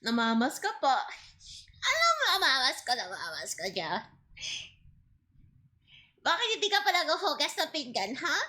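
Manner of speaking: nagging Filipino grandma. Acted. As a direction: scold like an old lola. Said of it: "you want me to be a grandma next".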